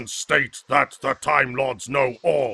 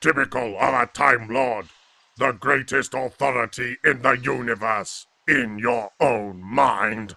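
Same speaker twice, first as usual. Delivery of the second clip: ordinary talk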